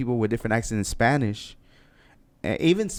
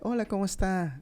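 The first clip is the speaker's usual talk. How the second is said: high, soft Spanish greeting; uppity, higher pitch; 9 semitones higher, 5 dB quieter than usual. Shown as a demonstration. Man showing how Latinos greet each other, uppity and high. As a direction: lift the voice high and keep it light. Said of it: "you're talking more, like, uppity".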